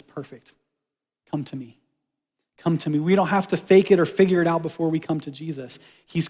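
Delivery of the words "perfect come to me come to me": gentle God voice. Pretend. God's gentle invitation voiced.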